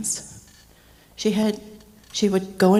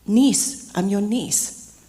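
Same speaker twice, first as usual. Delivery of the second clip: insistent repeat; 2 semitones higher, 5 dB louder, much slower than usual. Retold or imitated. Niece repeating herself to her confused uncle, insistent. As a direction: spell it out slowly and firmly to someone not getting it.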